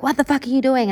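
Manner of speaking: high-pitched but held-back mock outburst; retold losing it. Recalling what she'd say to brother, muted retold outburst. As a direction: replay the angry demand without raising volume. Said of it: "I would lose my shit at this guy".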